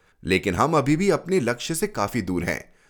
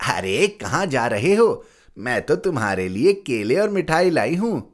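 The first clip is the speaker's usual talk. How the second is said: higher, fond aunt's voice; 4 semitones higher than usual. Acted. Aunt greets her nephew with treats, higher and fond. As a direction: coax the child affectionately as his aunt. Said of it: "बुआ ने ... पूछा".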